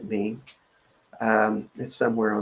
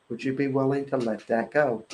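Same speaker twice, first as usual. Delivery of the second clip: ordinary delivery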